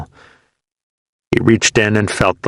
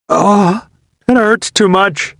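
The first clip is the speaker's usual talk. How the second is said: crying in pain; 13 semitones higher, slower than usual. A narrator's reading. Wounded soldier crying to his buddy about the pain. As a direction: sob it out hurting.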